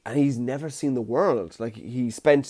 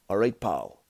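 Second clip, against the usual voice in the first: put-on lightly done Scottish Capaldi accent, softer; a hint of Scottish Doctor Who; Peter Capaldi impression; 3 semitones lower, 3 dB quieter than usual. Vlogger does his Scottish Peter Capaldi impression, softer. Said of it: "what impression is this? Peter Capaldi".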